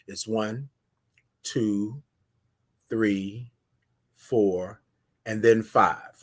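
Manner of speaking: slow, soft, spaced-out count to five. Shown as a demonstration. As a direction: count it out slowly with long pauses. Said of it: "it's a different five pace".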